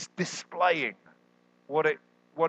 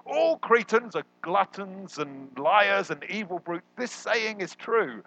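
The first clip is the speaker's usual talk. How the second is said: slow, lofty quoted proclamation, pitched higher; quoting Paul; 7 semitones higher, slower than usual. Retold or imitated. Preacher quoting Paul's line to the church, slow and lofty. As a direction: read it out slowly like grand scripture. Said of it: "where he says".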